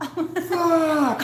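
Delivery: long high-pitched cry of frustration; laughing imitation of a man losing it; crazed mock yell. Acting out the film character finding only snowballs, crazed yell. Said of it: "He's all going crazy and shit".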